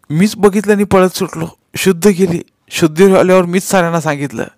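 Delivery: put-on high-pitched child's account; small boy's high voice; frightened young boy. A narrator's reading. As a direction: explain anxiously to the police. Frightened boy answering inspector.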